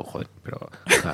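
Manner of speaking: soft unvoiced swearing under the breath; muttered aside. DJ curses under his breath on realizing the mistake, whispered. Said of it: "Y yo, yo".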